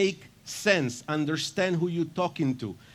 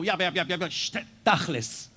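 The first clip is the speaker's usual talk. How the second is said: impatient Israeli; 3 semitones higher than usual. Retold or imitated. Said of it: "So when people speak in Israel, you go".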